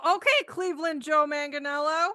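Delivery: high-pitched mock squeal; retold scream. Retelling spotting the handsome gardener to a friend, mock scream. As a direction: squeal it in playful, hammed-up admiration. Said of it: "and you scream".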